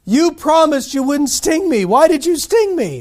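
mock-wounded disbelief at the betrayal; betrayed beaver. Beaver confronts scorpion.

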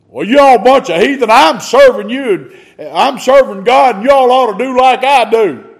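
high, loud, fast mocking brag; boastful Peter. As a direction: lord it over the heathen. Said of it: "That's what Peter said".

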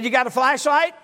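urgent demand. Retold or imitated. Locked-out pastor demanding a flashlight, loud and urgent. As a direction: demand it right now. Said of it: "I said".

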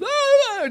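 high, loud mock wail; staged crying wail, high and loud; fake wailing sob. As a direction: ham up a teenage sob. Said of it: "I turned on the waterworks".

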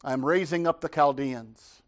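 God answering the prophet. Acted. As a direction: announce it as God, with weight. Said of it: "God answers and says".

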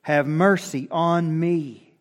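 loud, high, insistent plea. Acted. Blind beggar calls to Jesus despite the crowd, crying out. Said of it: "cried out all the more".

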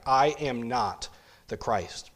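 each word stretched slowly and deliberately; slow denial, leaning on I and NOT; emphatic. Acted. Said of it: "John was emphatic".

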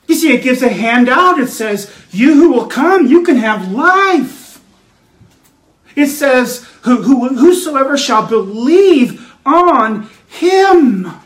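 emphatic pulpit proclamation with drawn-out endings; rousing preaching. Acted. Preacher offering life to all who come, rousing preaching. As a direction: stretch the key words out long.

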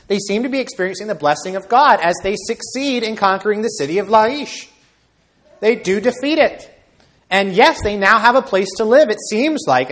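high, loud, forceful sermon delivery; impassioned preaching. Real, not acted. Preacher telling congregation of Dan's seeming success, impassioned.

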